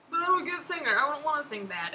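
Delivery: hammed-up self-quote, high and loud; mock whiny protest. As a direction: play up the offended good singer. Voicing her own karaoke protest.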